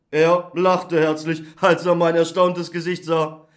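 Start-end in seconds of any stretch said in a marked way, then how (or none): none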